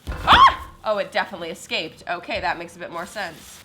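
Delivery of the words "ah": startled yelp. Real, not acted. Sudden yelp while unpacking groceries.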